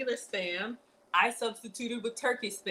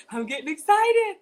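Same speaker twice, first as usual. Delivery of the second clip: higher and louder, bursting with excitement; getting excited; 9 semitones higher, 9 dB louder than usual. Real, not acted.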